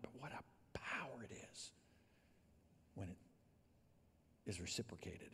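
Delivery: hushed whisper of awe. Acted. Pastor telling the church about love's power, whispered. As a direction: breathe it out like a secret.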